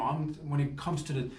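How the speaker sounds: usual speaking voice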